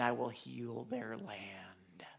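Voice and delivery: slow, hushed whisper that sinks low. Acted. Pastor reading a scripture promise to the church, whispered. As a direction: let the promise die away in a whisper.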